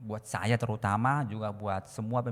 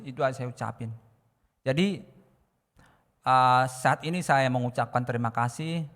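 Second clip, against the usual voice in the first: usual speaking voice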